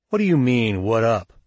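sneering challenge, falling at the end. A narrator's reading. Chiron to Shug on the steps, sneering. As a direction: throw the greeting back with a sneer.

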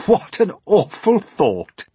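slow, high, loud exclamation; mock horror. Creatures reacting to eating James, amused horror.

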